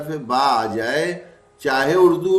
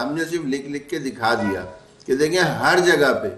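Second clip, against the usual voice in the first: ordinary talk, nothing marked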